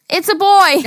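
put-on high, loud childish yell. Retelling how she yelled at grandma's turkey, a high loud yell. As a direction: shout it out like a delighted kid. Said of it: "I yelled".

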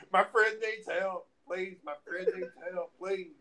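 hammed-up panicky begging; retold desperate plea. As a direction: plead for help in an emergency. Retold plea running into emergency room.

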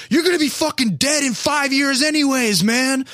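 high put-on mock sneer; nasty insult. Shirtless guy insulting the old guard, high mock sneer. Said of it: "He goes".

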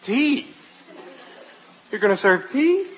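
high-pitched, incredulous query; dismayed disbelief. Acted. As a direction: question it in dismay, voice jumping up. Dismayed guest hoped for stronger drink.

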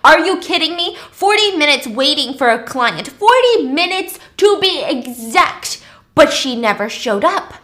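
furious client kept waiting forty minutes; angry rant. Acted. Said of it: "he's pissed off".

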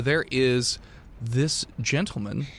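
normal talking voice

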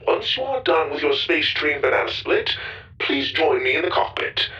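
robot voice through an intercom; robot over intercom. A narrator's reading. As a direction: announce like a ship robot.

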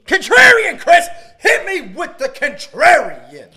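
very high, loud announcer holler; hammed-up shouting. Co-host demands Chris start the contrarian, hammed-up shouting. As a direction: holler the segment name like a race cry.

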